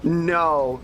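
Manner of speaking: usual speaking voice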